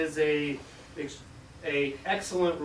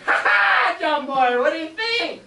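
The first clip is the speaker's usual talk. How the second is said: high, loud laughing boast; gloating laugh; 13 semitones higher, 9 dB louder than usual. Retold or imitated. Gina laughing and boasting to her little brother. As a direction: crow it at him.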